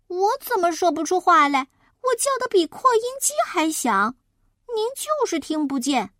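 put-on high-pitched, protesting kid voice; indignant child. Child protests that grandma cannot hear, high and indignant.

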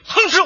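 high, sudden chomp noise; imitated biting grunt. Father biting the other father, imitated chomp. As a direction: mimic taking a fierce bite. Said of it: "我爸爸咬你爸爸一口".

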